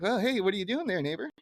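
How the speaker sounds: high cheery neighbor voice; Ned Flanders voice. Retold or imitated. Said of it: "it's like".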